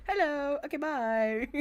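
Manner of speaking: playful quoted voice; imitated quick goodbye. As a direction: mimic her abrupt hello and bye. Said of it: "She just said".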